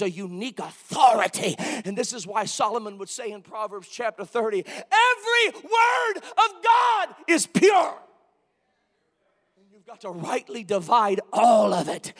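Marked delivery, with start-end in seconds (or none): 4.7-8.0 high, loud, slow, word by word; ringing proclamation. Real, not acted. Preacher quoting Solomon's proverb, high ringing proclamation. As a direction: ring out each word of the verse slowly.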